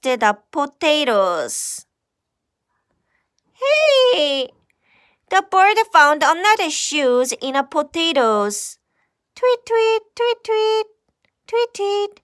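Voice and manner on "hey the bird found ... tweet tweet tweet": high, light bird voice chirping; little bird voice. Acted. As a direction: play a curious little bird.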